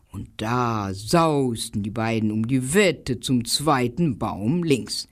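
ordinary delivery, nothing marked

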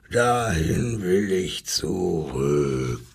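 low, slow lion growl; Löwe. A narrator's reading. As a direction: growl it drawn out with longing. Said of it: "schrie der Löwe". (tief und langgezogen, wie ein Löwe)

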